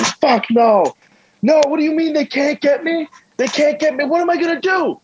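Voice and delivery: high, frantic, rising panic; a stranded man losing it on the phone; panicked phone call. Retold or imitated. Stranger panicking to someone on his phone, high and frantic.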